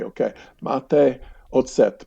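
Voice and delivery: slow, careful Czech, "ocet" said oh-tset; drilling the word "ocet" under the breath; rehearsing Czech. Retold or imitated. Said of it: "This is how I can say it".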